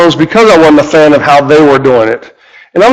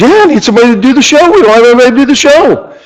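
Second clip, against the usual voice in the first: urgent and excited; 9 semitones higher, faster than usual. Retold or imitated. Radio host urgently needs a replacement.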